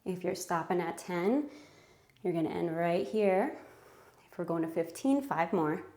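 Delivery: breathless workout talk; speaking between heavy breaths. Real, not acted. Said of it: "Super out of breath lately from my anemia".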